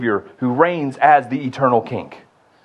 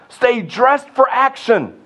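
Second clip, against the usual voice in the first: urgent command; 10 semitones higher, slower than usual. Acted.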